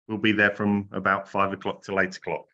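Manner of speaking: normal talking voice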